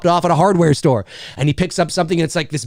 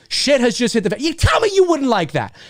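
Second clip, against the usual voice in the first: ordinary delivery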